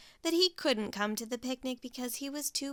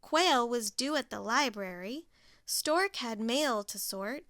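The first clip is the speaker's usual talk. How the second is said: plain reading voice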